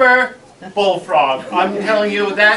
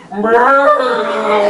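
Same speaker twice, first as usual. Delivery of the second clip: higher, louder cat meow; 6 semitones higher, 8 dB louder than usual. Retold or imitated. A noise from the box, a cat's meow. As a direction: mew like a cat.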